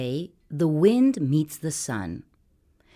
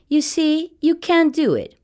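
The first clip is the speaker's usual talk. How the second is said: much higher, louder gloat; gloating sun voice; 12 semitones higher, 4 dB louder than usual. A narrator's reading. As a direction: crow over the wind, high pitched. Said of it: "says the sun".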